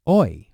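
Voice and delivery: "Oi" as in oil; teacher demo. Shown as a demonstration. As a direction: glide "Oi" like the oy in oil. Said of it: "as in the word oil".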